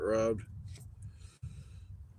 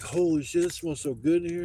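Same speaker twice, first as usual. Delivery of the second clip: higher, louder imitation of an impressed newcomer; impressed exclamation; 6 semitones higher, 5 dB louder than usual. Man walking into a smoky bathroom, imitated as impressed. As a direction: exclaim it like a retold delighted discovery.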